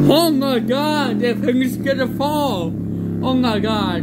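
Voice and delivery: high-pitched, amazed exclamation; alarmed amazement. Real, not acted. Speaker watching a thing about to fall, exclaiming high. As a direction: pitch it up, reacting as it happens.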